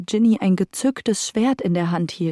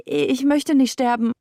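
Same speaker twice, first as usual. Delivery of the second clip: higher, desperate begging; pleading merchant; 6 semitones higher than usual. Acted. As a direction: beg for your life. Merchant begs for his life.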